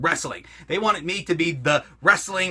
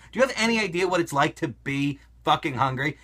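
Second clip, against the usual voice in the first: usual speaking voice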